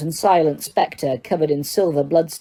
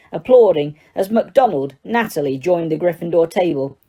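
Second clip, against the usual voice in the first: usual speaking voice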